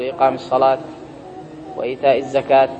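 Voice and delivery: الصلاة and الزكاة ending in a sounded t; pause on ta. Retold or imitated. Teacher imitating Hijazi scholars lecturing, pausing on ta. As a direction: close الصلاة and الزكاة on t, softly. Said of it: "يقفون على".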